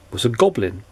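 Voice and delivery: plain reading voice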